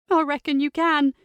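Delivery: put-on young niece, hurt and tense; teenage girl voice. Teen niece Sophie voiced.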